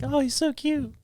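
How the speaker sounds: put-on high cooing girl voice; sorority girls cooing. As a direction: coo it like an adoring college girl. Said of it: "all the sorority girls were like".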